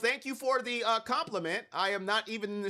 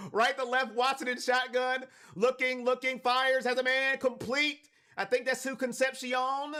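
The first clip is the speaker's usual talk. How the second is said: live play-by-play; 5 semitones higher than usual. Real, not acted. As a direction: call the play with rising punch, stretching big phrases.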